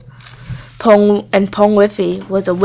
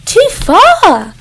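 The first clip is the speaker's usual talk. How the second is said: firm; 11 semitones higher, 5 dB louder than usual. A narrator's reading. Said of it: "said firmly".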